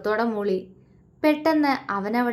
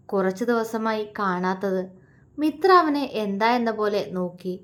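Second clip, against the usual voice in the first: ordinary delivery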